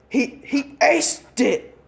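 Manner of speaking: high, slow, stammering amazement; can't believe the boy aced it; stunned disbelief. Acted. Examiner stunned by the test, high slow disbelief.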